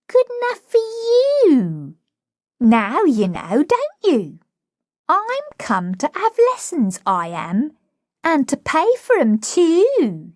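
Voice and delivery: put-on high, indignant cockney girl; haughty protest, pitched high; proud flower girl. Flower girl offended at the housekeeper, indignant.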